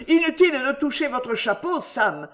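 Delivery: high, urgent interruption; quick, lively retort. A narrator's reading. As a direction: cut in anxiously to stop him. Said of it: "reprit vivement monsieur Winkle".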